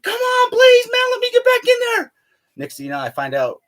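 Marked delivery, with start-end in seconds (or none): 0.0-2.1 very high, louder frantic shout; desperate plea. Retold or imitated. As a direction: yell at the console to let you back in. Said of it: "I'm all freaking out".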